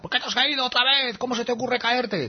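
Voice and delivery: put-on high-pitched scolding at a fallen child; mock scolding, pitched high; mock yell at child. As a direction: pretend to scold a child who fell, voice shooting up. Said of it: "nadie le grita".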